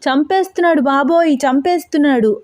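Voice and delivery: high, panicked cries of pain; wailing under blows; screaming in pain. A narrator's reading. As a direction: yelp as the belt lands. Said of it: "అని అరుస్తూ గావు కేకలు పెడుతూ".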